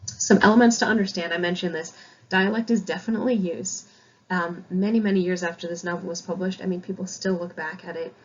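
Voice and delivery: ordinary talk, nothing marked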